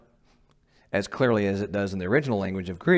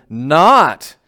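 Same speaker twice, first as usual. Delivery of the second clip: not hit hard and loud; a sudden loud not; stress on not; 3 semitones higher, 13 dB louder than usual. Real, not acted. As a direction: punch the word not. Preacher punches the contrast word.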